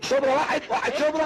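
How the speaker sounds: high, loud hawking cry; mock driver's call. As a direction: call it out like a minibus tout. (نداء سواق ميكروباص بصوت عالي)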